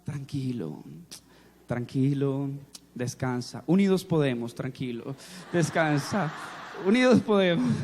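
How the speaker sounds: low pastor's voice soothing a half-asleep man; soothing murmur. Retold or imitated. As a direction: hush him back to sleep. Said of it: "una voz que decía".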